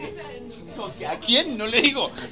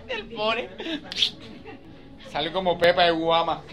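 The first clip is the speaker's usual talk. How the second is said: chuckling through the words; amused preacher laughing at the robber. Real, not acted. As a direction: laugh while telling it. Laughing at the story.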